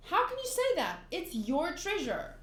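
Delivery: acted disbelief, starting very high; indignant protest. Friend protests that it is Gaimon's treasure, indignant.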